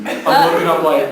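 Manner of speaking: ordinary delivery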